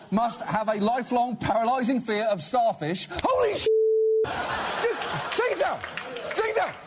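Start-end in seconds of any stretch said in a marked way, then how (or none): 3.2-3.7 much higher sudden shriek; startled yelp. Acted. Startled by starfish. 4.3-6.9 high, frantic begging; panicked plea. Acted. Panicked plea about starfish.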